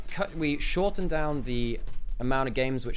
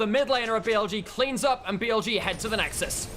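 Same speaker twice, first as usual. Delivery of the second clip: climactic play-by-play; 10 semitones higher, 3 dB louder than usual. Acted. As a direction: sell the game-winning moment. Caster calls the game-ending fight.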